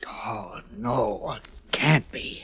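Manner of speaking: breathy, sinking disbelief; dismayed whisper. Acted. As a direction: let it drain out in a whisper. Dismay at the bad news.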